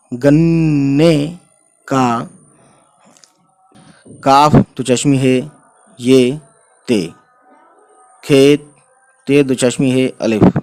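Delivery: slow beginner's read-aloud, word by word; drawn-out syllables for new learners. Shown as a demonstration. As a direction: spell and read slowly, with long pauses. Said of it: "मैं इतना slow इसलिए पढ़ रहा हूँ".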